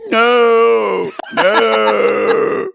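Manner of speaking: loud, high mock wail; long drawn-out shouted lament; wailing, imitating Darcy. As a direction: cry out as if in grief, hammed up. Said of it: "Darcy goes".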